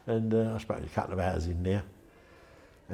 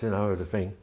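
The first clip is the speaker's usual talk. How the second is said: usual speaking voice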